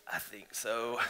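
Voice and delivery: very soft, timid admission. Retold or imitated. Guest answers counselor about disappointment in God, timidly. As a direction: say it small, as if scared to admit it. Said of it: "I think I very timidly said".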